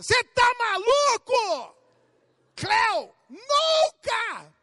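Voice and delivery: high, loud, slow "Cê tá maluco?"; cutting shout. Retold or imitated. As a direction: shut him down with a yell. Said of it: "Dá uma tesourada". (esposa cortando o marido aos gritos, aguda e alta)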